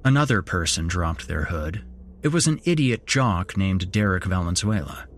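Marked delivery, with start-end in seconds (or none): none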